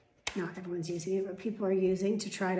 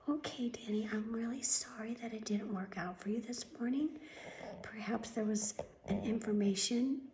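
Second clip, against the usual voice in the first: mocking receptionist whisper; 9 dB quieter than usual. Mocking the doctor's office receptionist, soft whispered voice. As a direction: mimic her limp, whispery phone voice. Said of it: "this is how they talk".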